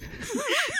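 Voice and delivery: bright high burst of laughter. Real, not acted.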